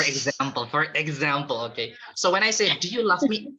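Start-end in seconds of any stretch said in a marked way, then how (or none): 2.8-3.5 "love" said "laff", with an f; mispronunciation. Shown as a demonstration. Teacher quizzing the class, "love" mispronounced as "laugh". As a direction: turn "love" into "laugh" in the question. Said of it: "Is it correct pronunciation?".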